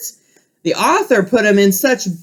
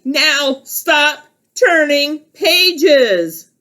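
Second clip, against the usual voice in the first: slow stern Grover; 5 semitones higher, much slower than usual. Acted. Grover orders.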